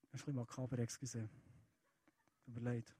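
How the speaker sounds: low, quiet aside; apologetic aside. Real, not acted. Preacher apologises to audience mid-quote, quiet aside. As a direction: drop your voice for a quick apology.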